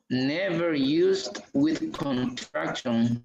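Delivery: very slow, each word of the rule drawn out; slow rule reading. Shown as a demonstration. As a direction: read the rule out slowly to the class. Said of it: "Por eso aquí dice".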